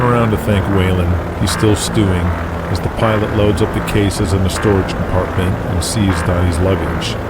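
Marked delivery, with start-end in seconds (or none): none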